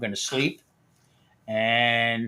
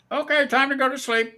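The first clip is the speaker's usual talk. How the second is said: higher-pitched mock Indian accent; imitating the Indian technician; 12 semitones higher than usual. Technician sending the patient to sleep, mock Indian accent. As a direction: cheerfully send the patient to bed. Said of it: "So he says".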